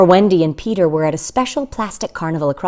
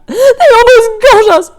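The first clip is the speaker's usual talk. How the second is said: high, loud, near tears; wife crying out in terror at the nursery; frightened cry; 13 semitones higher, 8 dB louder than usual. Acted.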